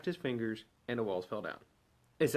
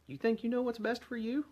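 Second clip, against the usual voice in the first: much higher, disbelieving question to the room; incredulous challenge; 10 semitones higher than usual. Real, not acted. Preacher challenging the congregation, incredulous and high. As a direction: needle them with disbelief.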